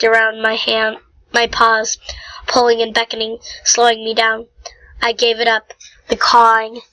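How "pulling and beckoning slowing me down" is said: usual speaking voice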